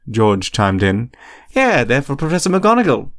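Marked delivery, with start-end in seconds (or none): 1.5-3.1 high, eager chiming-in; higher-pitched boyish agreement; eager echo. A narrator's reading. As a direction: back up your twin eagerly. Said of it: "Fred echoed immediately".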